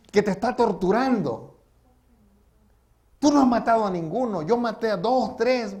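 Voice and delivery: high, loud, tormented outburst; aggressive shouting. Retold or imitated. Killer shouting at pastor who cannot understand his guilt, aggressive shout. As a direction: shout it accusingly, acting out an enraged man. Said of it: "gritando el hombre me decía".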